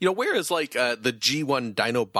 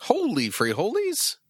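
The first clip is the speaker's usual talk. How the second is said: surprised; 14 semitones higher than usual. Real, not acted. Surprised at the price.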